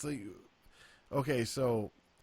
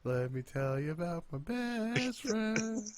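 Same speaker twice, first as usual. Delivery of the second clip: higher, slower mock catchphrase; Cole catchphrase; 8 semitones higher, slower than usual. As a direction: drag out the catchphrase. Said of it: "all I could hear was".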